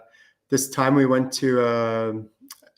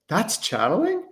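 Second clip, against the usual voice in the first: high, rising incredulous question; astonished disbelief; 9 semitones higher than usual. Retold or imitated. Student learning this is channeling, astonished. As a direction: act out a student's surprise, pitched up.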